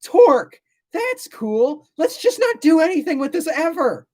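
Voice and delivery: mock the clueless Greeks in a silly high voice; mocking ancient Greeks. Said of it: "they looked at that and they went".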